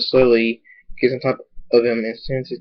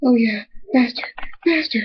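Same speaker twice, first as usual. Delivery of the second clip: moaning that climbs far above the usual voice; high-pitched acted moan; 11 semitones higher than usual. A narrator's reading. Stallion urging his partner on, very high moaning. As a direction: plead urgently in a high, moaning voice. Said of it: "he moans and says".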